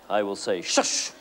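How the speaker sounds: sharp unvoiced shush to silence someone; a firm "Shush!" hissed out. Shown as a demonstration. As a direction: shush meaning be quiet now. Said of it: "if I want you to keep quiet".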